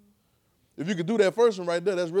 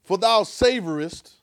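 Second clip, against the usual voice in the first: slow emphatic quote; 3 semitones higher, 8 dB louder, slower than usual. Acted. Preacher quoting scripture slowly.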